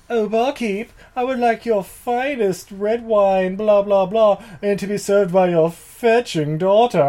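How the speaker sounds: high, loud snooty brat; drunken posh sneer. Retold or imitated. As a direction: order like a smug spoiled aristocrat. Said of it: "they're like".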